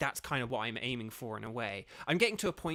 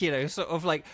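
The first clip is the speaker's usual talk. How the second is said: ordinary talk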